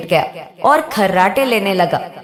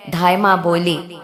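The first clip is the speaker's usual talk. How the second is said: ordinary delivery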